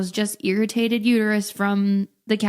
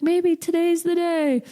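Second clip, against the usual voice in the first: higher, slow, lightly voiced husband; sing-song hope like every morning before; hopeful husband; 9 semitones higher, slower than usual. Husband cheering up his pregnant wife, higher slow hopeful lilt. Voicing Nick's daily hope.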